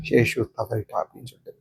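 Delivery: low, softened, halting storytelling with long pauses; subdued grief. Acted. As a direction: let the bad news sink in quietly. Recounting grandmother's sudden death. (தாழ்ந்த குரலில் சோகமாக)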